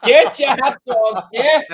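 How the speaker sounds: put-on old-time boardwalk vendor. Hosts riff on old boardwalk vendors, hammed-up hawker call. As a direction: bark it like a nineteen-twenties hot dog seller. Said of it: "Atlantic City Boardwalk circa nineteen twenty-two".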